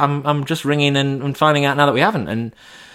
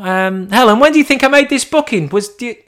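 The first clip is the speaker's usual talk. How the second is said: high, vague, put-on uncertainty; mock-clueless caller; 12 semitones higher, 3 dB louder than usual. [name] asking Helen mid-call, high mock confusion. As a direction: play dumb to the mate.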